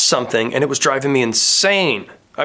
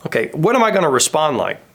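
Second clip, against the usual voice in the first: usual speaking voice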